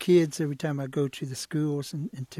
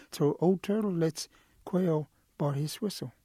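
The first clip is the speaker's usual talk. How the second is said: plain reading voice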